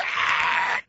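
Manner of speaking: breathless fake scream; a startled shriek with no voice; mock scream. Son screaming in panic during driving lesson, breathy shriek. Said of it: "He's like".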